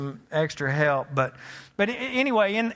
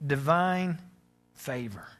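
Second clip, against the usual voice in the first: reflective sermon; 3 semitones lower than usual. Acted. Quiet reflective sermon close.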